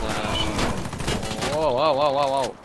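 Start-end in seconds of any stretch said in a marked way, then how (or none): 1.5-2.5 excited. Real, not acted. Player sees something surprising.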